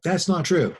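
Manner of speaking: sharp, loud and high, cutting the teacher off; flat denial. Retold or imitated. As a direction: snap back in open defiance. Student contradicts teacher.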